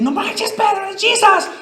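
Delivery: high, surprised cry to Peter; excited call. Retold or imitated. John telling Peter it's Jesus, excited high call. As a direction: blurt it out, amazed. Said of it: "Juan le dice".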